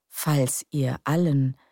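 ordinary delivery, nothing marked

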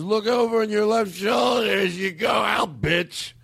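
put-on high, slow drunken yell; drunk angry shout. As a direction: bellow it like a bitter drunk. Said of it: "He goes".

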